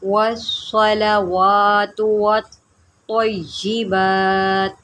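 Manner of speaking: वत्तय्यिबात with वत् joined to तय्यि, slow; slow model recitation for students. Shown as a demonstration. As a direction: recite वस्सलावातु वत्तय्यिबात slowly, linking the letters. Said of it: "इसको इसके साथ मिलाएं".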